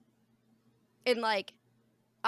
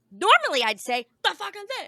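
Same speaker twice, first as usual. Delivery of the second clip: mock harsh exclamation; 11 semitones higher, 8 dB louder than usual. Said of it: "I said".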